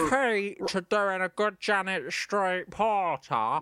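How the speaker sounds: slushy, toothy Cockney drawl; slow, exaggerated Cockney demonstration; Janet Street Porter. Shown as a demonstration. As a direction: talk as if spitting out your own teeth. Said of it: "sound like you're trying to spit out".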